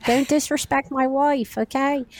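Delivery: mock warning in a Delco accent. Shown as a demonstration. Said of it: "I was doing the accent".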